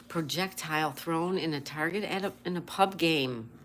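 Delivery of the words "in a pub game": normal talking voice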